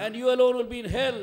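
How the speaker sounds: loud, high preaching cry; fiery preaching. Acted. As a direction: thunder it at the congregation. Preaching at full force.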